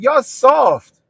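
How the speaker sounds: high, loud scoffing taunt. Real, not acted. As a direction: shout it as a tease. Mocking kids loudly.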